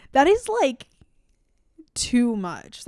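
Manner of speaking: high, loud indignation; outraged. Real, not acted. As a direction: pitch it way up in outrage. Outraged at the book.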